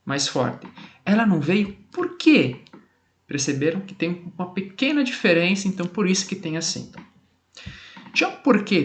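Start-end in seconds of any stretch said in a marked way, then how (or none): 1.0-2.6 "por quê" said strong at the end of the question; firm, emphatic "por quê" closing the question; stronger por quê. Shown as a demonstration. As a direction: punch the final "por quê". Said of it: "Já aqui tem um porquê mais forte".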